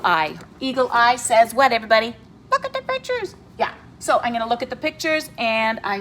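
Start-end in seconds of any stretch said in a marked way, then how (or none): 2.5-3.3 piepstemmetje van de arend, veel hoger; a squeaky high cartoon helper voice; high character voice. Teacher voices eagle eye character.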